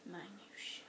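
soft unvoiced mock quote; whispered mocking quote. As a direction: whisper it back teasingly.

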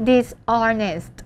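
dishonest with a silent h, dis-ON-est. Shown as a demonstration. Teacher showing students how to read it, silent H in dishonest. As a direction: say "dishonest" as dis-onest, no h sound. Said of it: "เสียงออ่าง".

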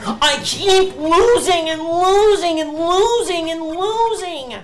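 exasperated wail at the game; whiny despair. Real, not acted. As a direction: stretch every losing like a wail. Whining about repeated losses.

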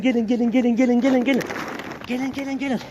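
high, loud repeated call; calling the dogs. Real, not acted.

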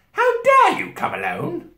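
very high, loud and slow witch; imperious outrage at the top of the voice; terrible witch voice. A narrator's reading. Witch rebuking the boy, high terrible voice. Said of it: "said the witch in a terrible voice".

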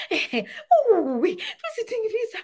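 laughing scornfully at a visitor's visa; mocking laugh. Scoffing relatives mock the newcomer, laughing. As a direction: cackle at them, then mock high.

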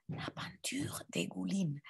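breathy classroom whisper for repeating. Shown as a demonstration. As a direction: whisper the whole phrase, no voice. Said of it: "Répétez". (chuchoté)

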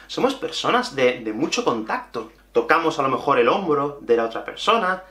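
usual speaking voice

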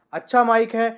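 ordinary talk

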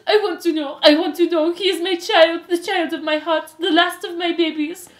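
high, loud melodramatic wailing; hysterical mother. A narrator's reading. Antoinette pleading for her child, hysterical. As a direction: wail it high and loud, building the plea. Mother begging the hooded mice.

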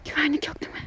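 unvoiced secret whisper. Real, not acted. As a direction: whisper it so guards won't hear. Sneaking into guarded area. (fısıltıyla)

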